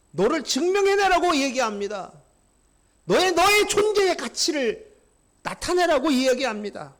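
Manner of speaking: loud, high, full-force preaching; a climactic preacher's shout; fervent preaching. Acted. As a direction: drive the point home to the congregation.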